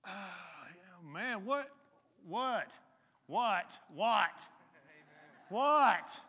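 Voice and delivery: woken sleeper building to loud, high "what"s; Jesus. Acted. Roused from sleep by scared followers, increasingly loud and exasperated. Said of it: "Here's what I picture. Jesus getting up".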